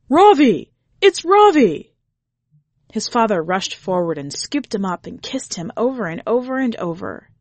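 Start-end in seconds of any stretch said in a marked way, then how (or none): 0.1-2.0 loud high cry of relief; joyful shout. A narrator's reading. Father finds his lost son, shouting loudly.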